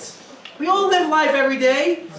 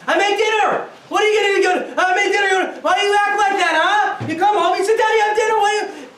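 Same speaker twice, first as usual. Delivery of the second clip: louder, higher angry outburst at the husband; hammed-up fed-up wife yelling about dinner; 6 semitones higher, 3 dB louder than usual. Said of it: "Now she gets mad".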